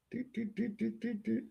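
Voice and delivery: high, soft doot doot like slow keystrokes; mock typing sounds. Shown as a demonstration. Showing the audience his slow typing, high soft doots. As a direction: tap out slow typing with your voice. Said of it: "Y'all see how slow I type?".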